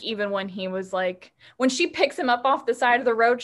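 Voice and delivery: normal talking voice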